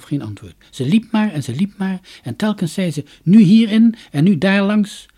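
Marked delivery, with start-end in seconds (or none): 3.2-5.1 higher and louder, sure of the way; eager guiding call. Acted. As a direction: call out the path like you know it. Dunja leidt zelfverzekerd de weg. (gretig en luid roepend)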